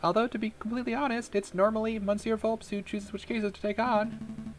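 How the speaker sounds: high little mouse voice; Mousy. Acted. Mouse confiding about the boss, high put-on voice. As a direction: keep it up in a squeaky register. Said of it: "I cannot keep doing this voice".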